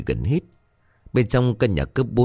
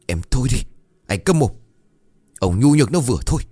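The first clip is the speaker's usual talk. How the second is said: angry scolding; 4 semitones higher, 4 dB louder, faster than usual. Acted. Wife snaps at husband.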